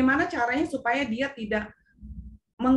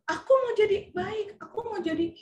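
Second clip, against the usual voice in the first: put-on child's reply; 5 semitones higher than usual. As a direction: lightly imitate a child making a promise. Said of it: "Terus dia".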